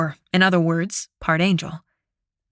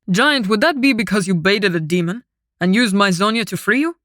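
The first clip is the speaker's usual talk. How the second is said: stern, raised commander voice; angry; 3 dB louder than usual. Acted. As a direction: rebuke him, voice pushed louder. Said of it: "Mihail sounded angry".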